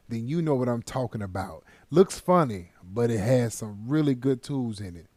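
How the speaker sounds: normal talking voice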